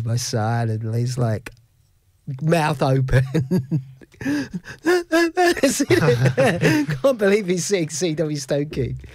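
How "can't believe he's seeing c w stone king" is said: laughing. Real, not acted. Laughing while telling.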